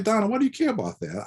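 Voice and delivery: high-pitched, quick friendly challenge; acted-out baffled pushback to a friend; retold incredulous question. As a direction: ask in high, puzzled disbelief.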